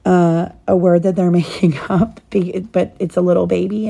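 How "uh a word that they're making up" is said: plain reading voice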